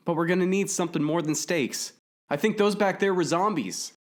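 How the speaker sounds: high, loud shouting over the wind. A narrator's reading. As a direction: keep yelling over the rushing air. Shouting over wind while cycling.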